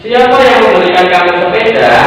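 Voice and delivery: reads the question aloud with a clear question lilt; questioning tune. Shown as a demonstration. Teacher reading a sample question to pupils, with a clear question tune. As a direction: model the question for the class. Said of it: "itu adalah termasuk contoh kalimat tanya".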